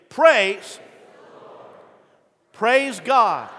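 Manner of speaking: loud, high, strong reading of "Praise"; read with umph. Acted. Pastor leading the congregation in reading, loud. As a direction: give it some umph. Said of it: "we read with some umph".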